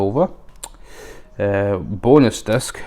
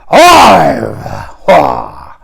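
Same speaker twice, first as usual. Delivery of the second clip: much higher and louder roar of alive; Pacino shout; 7 semitones higher, 11 dB louder, much slower than usual. Retold or imitated. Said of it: "he talks like this".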